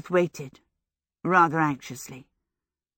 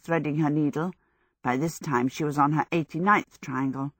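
ordinary delivery, nothing marked

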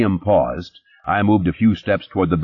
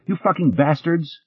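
angry; 10 semitones higher than usual. Acted. Redhead cursing the cops.

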